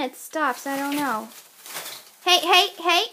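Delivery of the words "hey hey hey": high, very loud warning shout; urgent calling out. Real, not acted. Owner shouting at her fighting pets, high and urgent.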